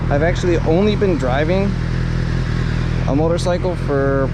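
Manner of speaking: muffled through helmet mic; talking into helmet mic while riding; inside a helmet. Real, not acted. Moto vlog recorded inside helmet.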